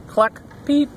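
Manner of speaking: high little cluck and peep; high storybook bird calls; hen and chick noises. A narrator's reading. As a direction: cluck and peep like farm birds. Animal noises as all climb aboard.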